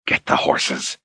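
unvoiced, hissed through gritted teeth. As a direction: hiss it with clenched jaw. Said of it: "he hissed through gritted teeth".

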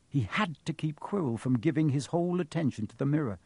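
ordinary delivery

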